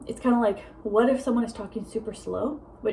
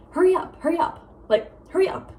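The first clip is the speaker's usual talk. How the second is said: higher-pitched mock urging; impatient hurrying; 7 semitones higher, 3 dB louder than usual. What she'd never tell grandpa, mock impatient urging. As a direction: hurry someone along, imitated impatience. Voicing rude demand to slow talkers.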